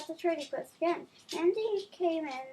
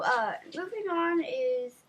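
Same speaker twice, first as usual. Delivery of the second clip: ordinary delivery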